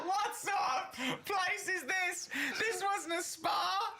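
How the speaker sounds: very high, drawn-out mock wail; electrocuted customer. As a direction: shriek it slowly as if being electrocuted, hammed up. Acts Carl being electrocuted at spa.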